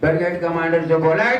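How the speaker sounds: normal talking voice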